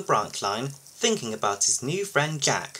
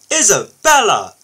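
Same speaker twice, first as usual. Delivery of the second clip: loud, sharp reproach; high, forceful scolding; 6 semitones higher, 11 dB louder than usual. A narrator's reading. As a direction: call the name as a telling-off. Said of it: "scolded Kelly".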